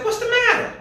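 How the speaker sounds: high, loud mock of the Danite men; mocking tribesmen. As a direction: throw it back at him like a jeering raider. Danites mockingly answer Micah.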